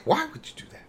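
a lightly voiced, high-pitched pleading question; hurt father. Quoting the father who forgives her, lightly voiced high plea. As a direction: ask it like a wounded father, only half acted. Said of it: "He's like".

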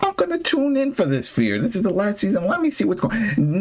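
high-pitched mock fan chatter; imitating hopeful viewers. Host mimicking people who decide to tune in, mock-enthusiastic. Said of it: "for people that are like".

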